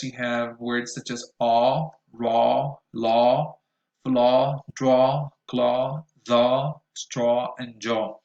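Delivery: word-final aw said long and loud; listen and repeat words. Shown as a demonstration. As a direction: drill the end-of-word aw clearly. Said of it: "at the end of words".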